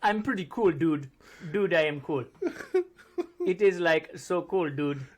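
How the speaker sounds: loud, hammed-up Indian accent; exaggerated Indian accent. Indian man claiming an American accent, thick Indian accent. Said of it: "Do you like my American accent".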